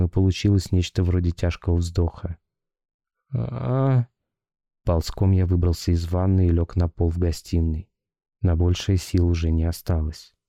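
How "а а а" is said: high thin moan like a sigh; thin ghostly moan. A narrator's reading. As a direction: let out a weak high groan. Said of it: "Но голос был тонким ... вздоха".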